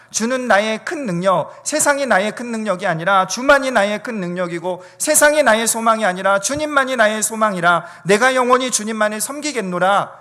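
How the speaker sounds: high, loud, ringing pulpit declamation; impassioned spoken hymn confession; fervent declaration of faith. Real, not acted. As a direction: proclaim it like a vow before the congregation.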